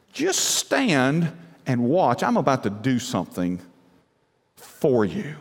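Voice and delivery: slow, deliberate divine instruction; measured and low, pausing before the last words; voice of God. Acted. God telling his people to stand and watch, slow and deliberate. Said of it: "but sometimes he says".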